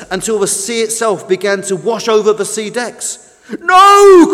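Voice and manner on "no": long, high, loud shout; crying out. A narrator's reading. Tug sees the new boat sinking, cries out loudly. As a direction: shout it in alarm. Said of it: "cried Tug".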